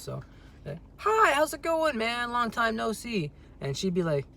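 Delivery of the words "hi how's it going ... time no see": a much higher, friendly greeting voice for the other person; playing someone else. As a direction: greet your friend as a different character, pitched high up. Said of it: "I'll also be someone else".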